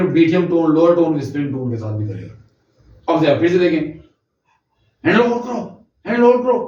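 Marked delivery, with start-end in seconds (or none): 5.0-6.6 high, firm, commanding call; firm high-pitched command; firm loud command. Shown as a demonstration. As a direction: give a clear firm order to the child. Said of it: "firm command और loudness के साथ".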